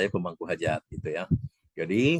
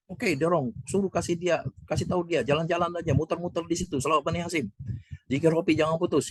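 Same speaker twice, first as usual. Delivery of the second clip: raised-pitch retold instructions; imitating Bunda's urgings; 8 semitones higher than usual. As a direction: pass on her urging in a higher voice. (menirukan Bunda memberi arahan dengan suara tinggi)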